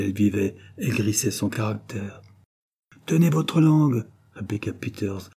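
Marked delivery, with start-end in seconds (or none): 3.1-4.1 higher-pitched curt order; sharp rebuke. A narrator's reading. Peters telling madame Cross to be silent, sharply. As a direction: shut someone up crossly.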